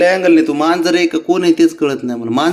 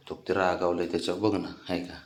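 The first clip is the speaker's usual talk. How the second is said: much softer, lower aside to the viewer; hushed remark about the pouting pet; quiet aside; 8 semitones lower, 14 dB quieter than usual. Real, not acted. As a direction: drop your voice to share it.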